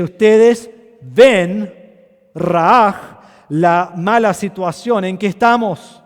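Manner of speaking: Nehemiah exhorting. Retold or imitated. Preacher voicing Nehemiah rallying the people, loud and urgent. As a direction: call the people to look at their trouble. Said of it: "le dice".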